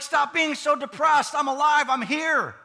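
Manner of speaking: high, loud, emphatic mock outburst; loud exclamation. Preacher as Jesus to gloomy followers, loud exclamation. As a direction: call it out to snap them out of it.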